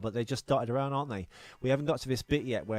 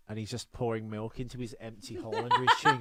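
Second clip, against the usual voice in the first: ordinary talk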